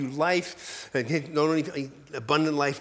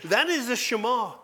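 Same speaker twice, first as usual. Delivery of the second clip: ordinary delivery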